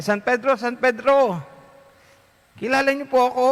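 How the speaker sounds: excited call. Acted. Dead lay minister calling to Saint Peter at the gate, eager.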